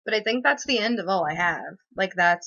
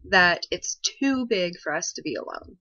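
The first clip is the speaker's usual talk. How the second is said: usual speaking voice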